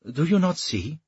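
sharp, impatient royal demand. Acted. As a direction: snap it out, losing patience. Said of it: "said the king impatiently".

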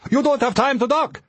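high-pitched, alarmed warning; urgent warning. A narrator's reading. Ari warning the pilot under attack, urgent cry. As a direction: warn them with pitch held way up. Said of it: "Ari warned".

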